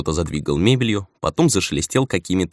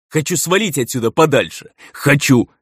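high, louder demanding cry; frustrated shout; 8 semitones higher, 4 dB louder than usual. A narrator's reading. Elder demands to leave the island, shouting. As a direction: yell it like a desperate demand. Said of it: "сорвался он на крик".

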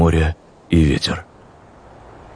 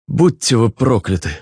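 bitter curse; 7 semitones higher than usual. A narrator's reading. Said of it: "наконец сказал он".

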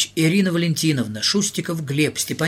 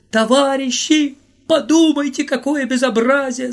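anguished shout; 10 semitones higher, 6 dB louder than usual. Acted. Volodya crying out to everyone on the platform, high despairing shout. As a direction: cry out to the comrades in despair. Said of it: "закричал он".

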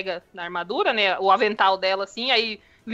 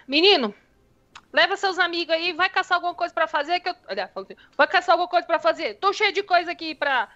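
high, fast hot-tempered scolding; 8 semitones higher than usual. Acted. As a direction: shoo them out like a busy mom. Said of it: "É esquentadinha essa daí".

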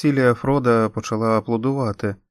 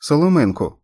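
short cry, higher and louder; urgent shout; 6 semitones higher, 6 dB louder than usual. A narrator's reading. Jolie shouts for a straw to save the baby, urgent cry. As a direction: shout for it right now. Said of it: "крикнула Джолі".

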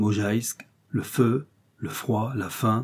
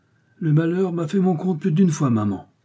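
a light mock of the captain, higher; imitating the captain; 7 semitones higher than usual. Suzon mimicking the captain to her friends, lightly voiced higher mock. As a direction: Suzon teasing by aping him. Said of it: "quand il disait à ma tante".

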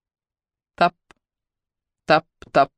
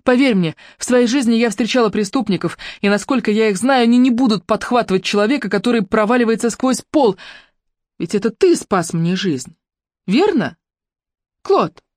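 lightly voiced young woman, higher and firm; Ruby, persuading; 5 semitones higher than usual. Ruby persuades Claude on the rope, higher voice. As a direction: win him over with conviction.

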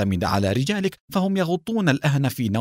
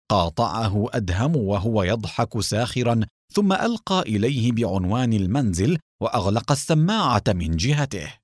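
ordinary delivery

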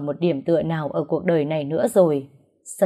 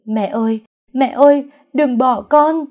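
louder, higher crying plea; sobbing wail; 6 semitones higher, 7 dB louder than usual. Acted. Daughter wailing over her mother's body, sobbing. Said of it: "gào lên tức tưởi".

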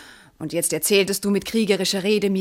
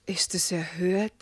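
incredulous whisper; 2 semitones lower, 6 dB quieter than usual. Acted. Wife outraged at husband's denial.

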